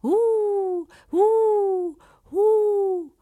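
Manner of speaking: high mocking owl hoots; owl hoot mimicked. Shepherd hooting back at the owl to mock it, high owl hoot. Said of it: "gridò allo stesso modo indietro".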